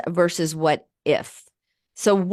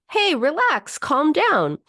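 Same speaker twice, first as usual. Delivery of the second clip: higher, dismissive "calm down"; mock calming; 8 semitones higher, slower than usual. A therapist mimics someone brushing off a tense person. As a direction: brush someone off with a breezy hey relax. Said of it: "If I just say".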